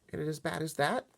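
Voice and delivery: put-on high girl's voice; worried girl. A narrator's reading. Sophie questions the giant, worried girl. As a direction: ask in dread.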